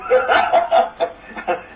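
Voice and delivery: breathy little chuckle; airy laugh. Real, not acted. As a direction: laugh it off lightly. Rabbi laughs at own spring cleaning.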